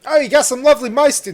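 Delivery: a playful jab dressed up as a compliment; mock compliment. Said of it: "No, I'm joking".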